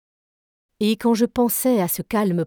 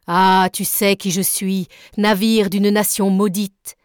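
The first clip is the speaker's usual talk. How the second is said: slow, loud and defiant; powerful cry; 4 dB louder than usual. Acted. Nemo crying out at the warship, powerful and slow. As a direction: hurl it at the enemy ship, slowly. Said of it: "s'écria-t-il de sa voix puissante".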